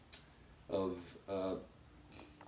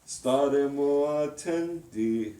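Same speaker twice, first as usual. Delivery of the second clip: staremo attenti, slow and higher; slow spoken Italian, stress on staremo; slow diction; 4 semitones higher, slower than usual. Shown as a demonstration. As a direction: model staremo attenti slowly. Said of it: "say it slowly".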